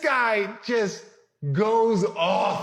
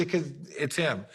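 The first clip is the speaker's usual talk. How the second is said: ordinary talk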